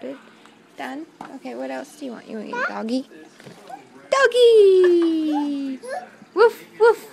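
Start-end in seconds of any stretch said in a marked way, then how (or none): 4.1-5.8 loud, laughing shout of the toy's name; excited cry. Real, not acted. Toddler grabs the toy dog. 6.3-7.1 loud, high mock woof for the toy dog; hammed-up loud dog bark for a toddler; playful barking.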